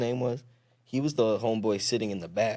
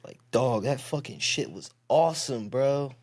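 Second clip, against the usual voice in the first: higher, stoked frat bro voice; frat boy voice; 5 semitones higher than usual. Shown as a demonstration. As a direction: gush like a fired-up frat guy. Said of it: "frat boy voice".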